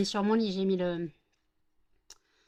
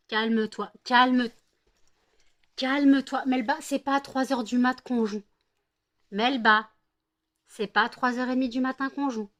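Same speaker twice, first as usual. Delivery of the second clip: louder, firm scolding; 3 semitones higher, 7 dB louder than usual. Real, not acted. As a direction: tell her off firmly. Scolding dog at 3 am.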